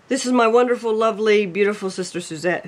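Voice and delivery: ordinary talk, nothing marked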